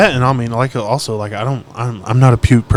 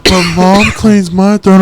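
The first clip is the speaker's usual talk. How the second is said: put-on slow, high, loud kid voice; mock little-kid whine; whining child; 6 semitones higher, 5 dB louder, much slower than usual. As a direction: imitate a kid's drawn-out protest. Imitating his child's whining protest.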